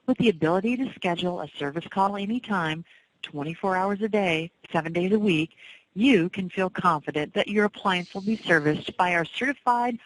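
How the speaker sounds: normal talking voice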